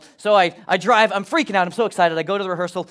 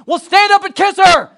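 pastor's shout; 12 semitones higher, 10 dB louder than usual. Retold or imitated. Pastor prompting the couple.

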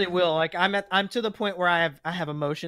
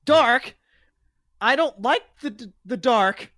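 high, frightened whimper about the dark; scared high voice; 7 semitones higher, 8 dB louder, slower than usual. Acted. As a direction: shrink back, terrified of the dark.

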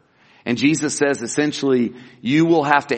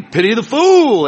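higher, louder tough-guy catchphrase; preacher imitating the TV star's famous line, higher and louder; Mister T impression; 7 semitones higher, 6 dB louder than usual. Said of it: "he, you know, he".

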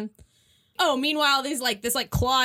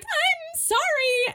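much higher, slow, drawn-out sorry; mock apology; much slower than usual. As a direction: stretch it out, pleading. [name] apologizing.

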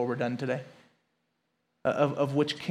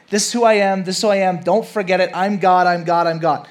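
emphatic God voice; 4 semitones higher, 7 dB louder than usual. Retold or imitated. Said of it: "he keeps saying".

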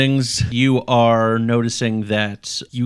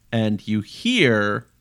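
usual speaking voice

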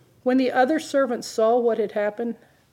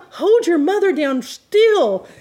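louder, higher, slow playful command; mock dad order; 6 semitones higher, 7 dB louder, slower than usual. Pastor retelling dad's bedtime order, playful mock shout.

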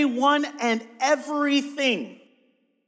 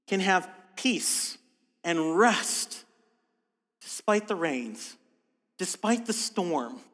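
calm reassurance; 3 semitones lower, 5 dB quieter, slower than usual. Acted. Reassuring congregation about coming storms.

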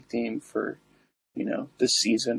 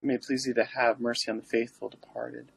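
prayer; 4 dB quieter than usual. Acted. Recites the litany.

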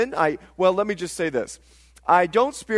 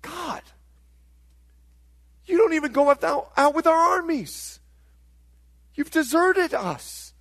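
high-pitched, pleading protest to God; higher-pitched, aggrieved prayer with long pauses; psalmists' lament; 7 semitones higher than usual. Acted. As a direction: cry out to God in hurt disbelief, drawing words out. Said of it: "and they say".

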